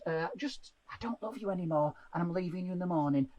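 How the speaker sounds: low, very soft, offhand; bedtime murmur. Acted. Partner rolling over in bed at night, soft murmur. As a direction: drop bad news quietly, half asleep.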